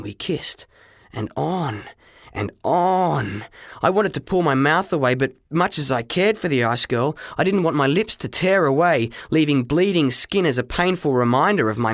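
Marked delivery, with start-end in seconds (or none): none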